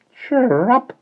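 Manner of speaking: bird chirp. A narrator's reading. Agreed the lark.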